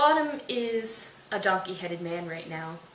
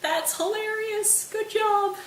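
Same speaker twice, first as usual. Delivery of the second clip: very high, drawn-out amusement; hammed-up high congratulation; mock delighted praise; 14 semitones higher, slower than usual. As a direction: crow with glee, way up high. Oberon delights in Puck's prank.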